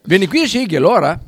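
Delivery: loud call across room. Real, not acted. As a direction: call him over loudly. Calling colleague over.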